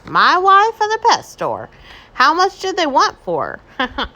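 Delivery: high, loud, slow mock surprise; joking husband. A narrator's reading. Mister Rogers joking about his wife, mock surprise. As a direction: ham up the husband's joke.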